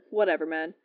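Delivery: low, dismissive shrug-off; a touch of scorn, low and casual; scoffing. A narrator's reading. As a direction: brush it off with a mild scoff. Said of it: "Quinlan scoffed".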